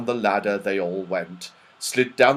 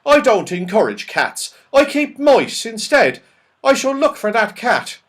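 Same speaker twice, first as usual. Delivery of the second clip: put-on high, loud old saucepan man; 11 semitones higher, 6 dB louder than usual. Old saucepan man refusing to encourage cats, high and emphatic. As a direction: voice a fussy old man, pitched up.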